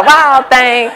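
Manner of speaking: can barely get the toy's tune out for laughing. Retold or imitated. Toy gorilla pressed again, giggling.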